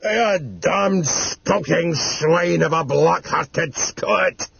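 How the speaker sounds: put-on slow, high-pitched cursing old man; furious yell. Old man cursing into the tape recorder, slow furious yell. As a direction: draw out every insult in an acted yell. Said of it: "he yelled".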